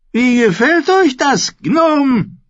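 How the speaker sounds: put-on a spoiled boy king jeering at the dwarf; mocking boy king. A narrator's reading. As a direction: hohnvoller junger König, viel höher und lauter. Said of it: "spottete Joffrey".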